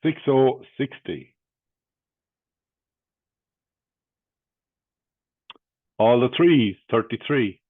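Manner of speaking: loud punchy bingo call, number then total; bingo caller. Acted.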